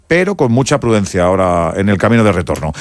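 usual speaking voice

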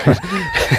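sudden high-pitched laugh; high burst of laughter. Real, not acted. Laughs at being called worn out.

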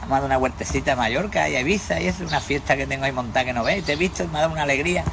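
high-pitched, lively joke voices; joke characters. Acted. Teller voicing two old friends meeting, high hammed-up voices. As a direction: pitch both men up and chatter.

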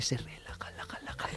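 very soft, breath-only whisper; ASMR whisper. Shown as a demonstration. Co-host demonstrates relaxing ASMR to audience, whispering. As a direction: whisper it repeatedly, fading away.

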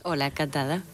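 quiet greeting, away from the mic; soft-spoken. Real, not acted. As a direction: say hello softly, too far from the microphone. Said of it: "habla bajito".

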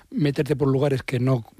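ordinary talk, nothing marked

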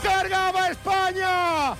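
slow stretched ecstatic shouting; goal celebration shout. Real, not acted. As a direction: savour every word, shouting high. (grito eufórico y alargado de celebración)

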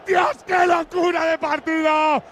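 stretched, high shouted commentary; frenzied goal call. Real, not acted. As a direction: roar it, stretched out.

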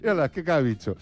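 high-pitched, amused through laughter; real laughter breaking into the words; laughing. Real, not acted. Laughing at the cats' photos.

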